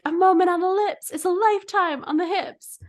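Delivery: mock screaming. Almond mom yelling at a rival, mock screaming. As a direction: imitate a scolding yell without full volume. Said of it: "screaming at them".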